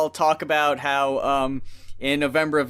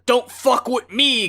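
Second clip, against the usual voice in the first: tough guy voice; 7 semitones higher, 4 dB louder than usual. Retold or imitated. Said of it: "he's not the ... guy".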